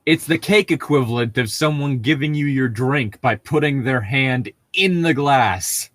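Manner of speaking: normal talking voice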